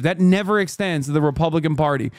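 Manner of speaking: ordinary talk, nothing marked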